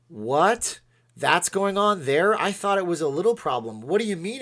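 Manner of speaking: mock shock. New world leaders hearing daily briefings, mock shock. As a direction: act out a stunned, incredulous reaction.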